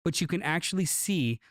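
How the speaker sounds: ordinary talk, nothing marked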